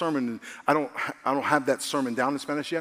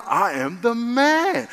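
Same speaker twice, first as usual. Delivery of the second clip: swaggering boast; 7 semitones higher, 5 dB louder, much slower than usual. Retold or imitated. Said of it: "And I'm like".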